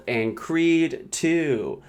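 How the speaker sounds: slow dramatic announcer drawl; drawn-out reveal. Pretend. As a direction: stretch every word slowly.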